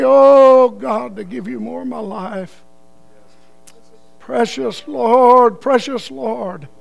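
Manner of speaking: slow, drawn-out pleading prayer with loud cries; fervent prayer. Acted. Preacher leads the congregation in prayer, fervent and drawn out. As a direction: pour it out to God, crying out.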